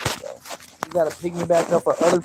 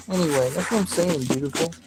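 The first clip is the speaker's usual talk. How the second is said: usual speaking voice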